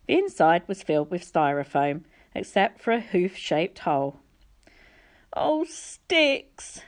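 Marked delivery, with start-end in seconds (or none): 5.3-6.8 put-on very high, muttered goat-boy grumble; mumbled satyr curse. Satyr fumbling his shoe, high muttered grumble. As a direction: grumble it at the fake foot. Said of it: "he mumbled".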